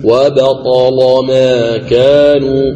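slow chanted وبطل ما كانوا with a thick, velarized L in وبطل; tajweed demo, heavy lam in وبطل, long vowels. Shown as a demonstration. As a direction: recite وبطل ما كانوا drawn out, darkening the "la". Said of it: "تغلظ اللام وجها واحد".

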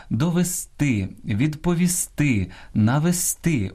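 end-stressed довести, відповісти, навести; stress on last syllables. Shown as a demonstration. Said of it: "Ось так наголошуються ці дієслова".

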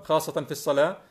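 normal talking voice

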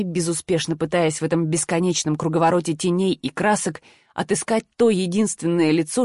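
ordinary delivery

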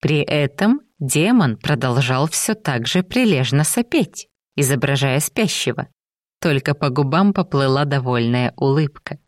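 ordinary delivery, nothing marked

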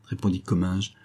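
ordinary delivery, nothing marked

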